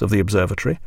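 ordinary delivery, nothing marked

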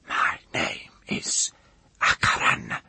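slow, voiceless whisper; intense whisper. A narrator's reading. Woman confiding her secret name, fierce whisper. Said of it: "She leant forward and whispered".

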